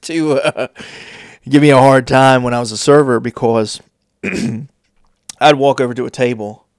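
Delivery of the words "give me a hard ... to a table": ordinary talk, nothing marked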